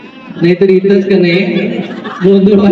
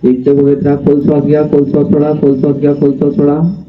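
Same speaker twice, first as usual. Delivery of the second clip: hypnotist voice; 7 semitones lower than usual. Acted. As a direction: lull them under like a stage hypnotist. Hypnotist induction voice.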